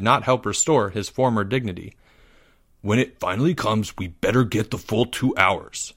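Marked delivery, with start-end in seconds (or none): 2.8-5.9 grumbling. Acted. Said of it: "he grumbled".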